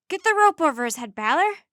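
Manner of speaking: fast, high, very loud command; yelled order. A narrator's reading. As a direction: yell urgently at your helper. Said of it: "she yelled".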